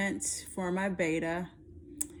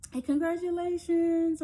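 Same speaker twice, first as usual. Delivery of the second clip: high, drawn-out excited cheer; gushing congratulations; 11 semitones higher, 5 dB louder than usual. Acted. Nurse giving happy beta news, gushing congratulations. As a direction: sing out mock-joyful good news. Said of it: "just from her tone".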